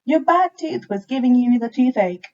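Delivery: chirpy little mouse explaining. A narrator's reading. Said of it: "answered the mouse".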